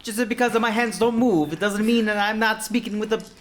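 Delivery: put-on high, loud indignant Italian accent; angry Italian. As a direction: protest in a hammy angry Italian. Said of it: "now I am angry Italian".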